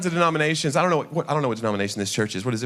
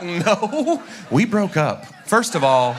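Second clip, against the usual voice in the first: higher, louder mock protest; mock indignant; 5 semitones higher, 4 dB louder, much slower than usual. Answering the lady about dating his sister, mock indignant. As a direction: protest it like a wounded party.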